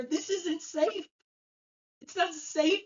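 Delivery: high, slow, frightened voice insisting it isn't safe; frightened protest. Retold or imitated. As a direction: plead like a scared grown man. Terrified patient protests.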